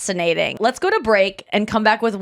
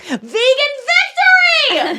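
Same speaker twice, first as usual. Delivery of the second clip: much higher, loud, slow victory cry; triumphant shout; 4 dB louder, much slower than usual. Retold or imitated. Comedian cheering at the New York chef, a high drawn-out victory shout. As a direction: crow it like a champion. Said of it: "I was like".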